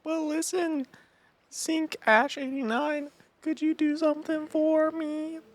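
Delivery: put-on very high falsetto girl. Game girl asking the player for a song, falsetto girl voice. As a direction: ask sweetly in a put-on high voice. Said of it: "My girl voice is the exact same".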